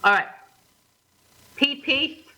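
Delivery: ordinary talk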